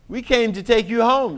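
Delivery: the smug big sister who knows best; big know-it-all voice. Acted. Sister telling Daisy to come home, know-it-all tone. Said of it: "in her big know-it-all voice".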